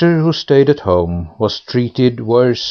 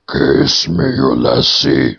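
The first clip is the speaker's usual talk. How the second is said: pool head voice; 9 semitones lower than usual. A narrator's reading. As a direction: croak it up from the pool. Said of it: "said the head".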